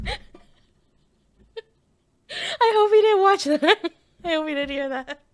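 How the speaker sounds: high, loud words through real laughter; laughing hard. Real, not acted. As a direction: crack up while talking, embarrassed by your own impression. Cracking up after own impression.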